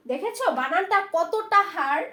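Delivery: ordinary talk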